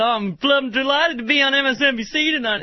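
smug, self-satisfied put-on voice; smirky Cantor. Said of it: "Cantor's so smirky".

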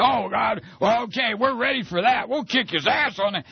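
put-on, high-voiced fighting talk; acted campaign bravado. Acts out campaign's eager reply.

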